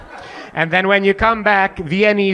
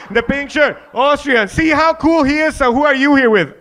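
mock host; 7 semitones higher than usual. Mocking himself, higher.

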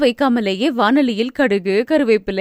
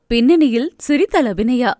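ordinary delivery

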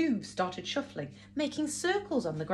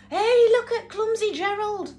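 high, loud jeering taunt; mocking animals; 12 semitones higher, 7 dB louder than usual. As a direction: jeer at him like a playground bully.

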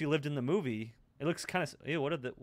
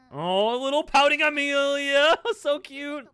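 cooing; 7 semitones higher, 6 dB louder than usual. Real, not acted. Viewer gushes over little pouting Amelia, high cooing. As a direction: gush in a high, loud cutesy voice.

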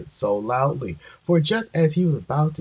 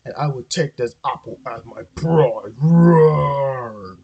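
roaring bear; 5 dB louder, slower than usual. A narrator's reading. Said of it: "roared the bear".

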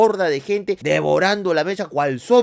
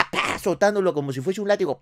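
ordinary talk

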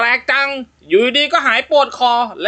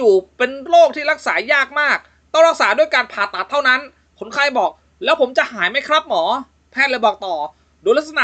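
raised storytelling voice with character lines; joke story narration; 5 semitones higher than usual. A narrator's reading. Host reads a doctor joke aloud, storytelling voice. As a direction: tell the hospital joke lightly.

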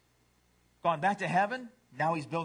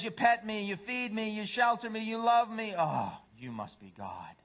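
adoring dog; 6 semitones higher than usual. Acted. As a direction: gush like a devoted dog, then drop in reverence. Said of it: "A dog says".

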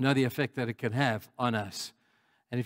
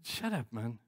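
much softer, low inner grumble; muttered thought; 3 semitones lower, 9 dB quieter than usual. Retold or imitated. Thinking about a whining drunk, softly muttered. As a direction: say it under your breath. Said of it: "And I'm thinking".

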